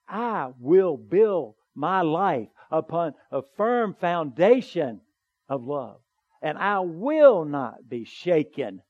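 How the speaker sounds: slow, deliberate, each word weighted; slow firm declaration. Shown as a demonstration. Preacher to congregation, slow firm declaration. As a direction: declare it like a vow. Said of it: "make that declaration".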